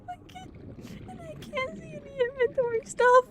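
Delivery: high, teary voice; breaking into a cry; on the verge of tears. Real, not acted. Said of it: "I'm genuinely gonna cry".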